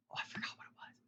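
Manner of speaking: breathy whisper of a quick aside. Real, not acted. Telling the listeners he forgot her words, whispered aside. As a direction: drop to a whisper, admitting a lapse.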